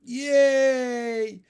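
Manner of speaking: high, loud drawn-out cheer; yelling. A narrator's reading. Big boys at a window cheer, yelling. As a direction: yell it like a rowdy spectator. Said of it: "yelled some big boys".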